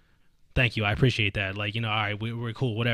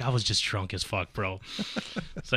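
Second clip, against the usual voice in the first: ordinary delivery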